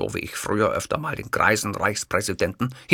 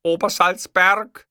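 higher, louder, slow correction; correcting Hitler; 10 semitones higher, 7 dB louder, much slower than usual. Acted. Hitler correcting the clerk, emphatic. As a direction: correct her word by word.

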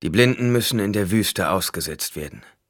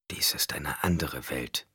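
slow hushed whisper; inner thought whisper; 3 semitones lower, 7 dB quieter than usual. A narrator's reading. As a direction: think it aloud in a whisper. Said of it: "dachte er".

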